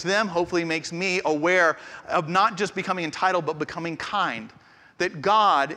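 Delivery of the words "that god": normal talking voice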